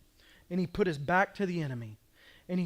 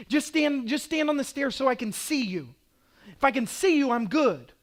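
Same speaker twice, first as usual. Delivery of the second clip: put-on higher, louder little-kid plea; a hint of pleading kids; 8 semitones higher, 6 dB louder than usual. Children begging Dad on the basement stairs, lightly voiced plea. Preaching build.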